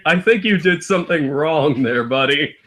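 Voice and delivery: usual speaking voice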